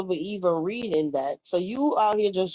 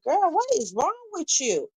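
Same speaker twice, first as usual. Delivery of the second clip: shocked disbelief; 9 semitones higher than usual. Retold or imitated. Retelling her own shocked reaction.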